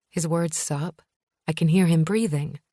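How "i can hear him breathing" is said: ordinary delivery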